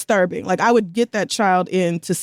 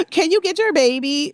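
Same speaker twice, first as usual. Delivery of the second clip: higher-pitched complaining bystander; judgy stranger; 6 semitones higher than usual. Retold or imitated. Stranger complaining to a struggling mom, mock nagging. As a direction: imitate a stranger butting in, pitched up.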